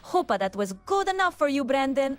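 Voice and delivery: cartoon Italian plumber accent, lively pitch swings; nervous but game little plumber with Italian accent; Luigi impression. Co-host performing an impression on a viewer's request, cartoon Italian accent. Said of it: "do Luigi accent".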